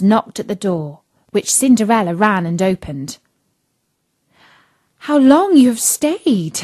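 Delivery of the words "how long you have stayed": higher, mildly exclaiming voice; feigned sleepy cry. A narrator's reading. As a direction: exclaim as if just woken. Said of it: "cried she, gaping, rubbing her eyes".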